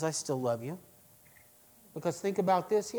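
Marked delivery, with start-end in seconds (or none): 0.0-0.8 tender. Acted. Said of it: "He says".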